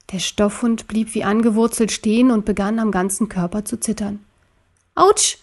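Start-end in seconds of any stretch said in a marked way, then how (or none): 5.0-5.4 sudden high-pitched cry of pain; called-out ouch. A narrator's reading. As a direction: yelp it out, as if bumping your head. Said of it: "rief Ariel".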